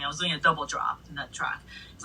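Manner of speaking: normal talking voice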